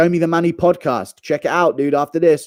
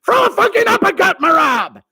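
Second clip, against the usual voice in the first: screamed Matt Serra impression. Fake cornerman barging in on the technique, screamed impression. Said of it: "out of nowhere I scream".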